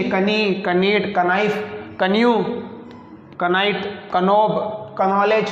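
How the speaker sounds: कनाइफ, कनॉलेज with "ka" before the N; कनाइट said as "ka-night", K fully voiced; wrongly voiced K. Shown as a demonstration. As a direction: sound the silent K: "ka-nee, ka-nife, ka-nowledge". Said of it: "ये कनी नहीं है".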